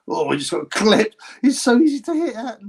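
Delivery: laughing, pitch climbing high; amused live reaction. Real, not acted. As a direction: call the hit with rising, amused excitement. Reacting as fighter gets clipped.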